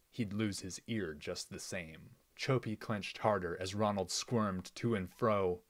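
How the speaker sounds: usual speaking voice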